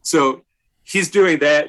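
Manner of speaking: usual speaking voice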